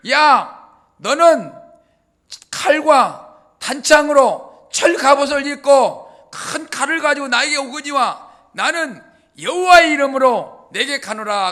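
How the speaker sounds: loud, slow, ringing defiance; bold war cry. Retold or imitated. Young David shouting defiance at the giant Goliath, ringing and bold.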